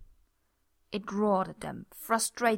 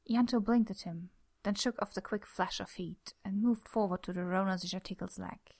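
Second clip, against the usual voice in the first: ordinary delivery, nothing marked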